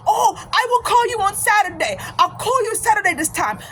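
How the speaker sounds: high, insistent imitated African accent; African-accented cheerful insistence; African brother's accent. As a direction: mimic an African brother who won't give up. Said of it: "this brother from Africa".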